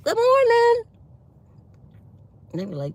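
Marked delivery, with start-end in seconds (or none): 0.0-0.8 high, loud chirped greeting; singsong greeting. Retold or imitated. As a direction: belt out a happy hello. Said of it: "I'm like".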